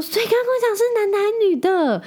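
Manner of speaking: eager mother-in-law. Retold or imitated. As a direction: imitate the impatient grandma on the phone. Mother-in-law demands the answer.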